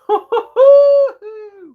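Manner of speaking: loud falsetto laugh with long last hoot; squealing hoots of laughter; high hooting laugh. Real, not acted. Laughing at awkward scene.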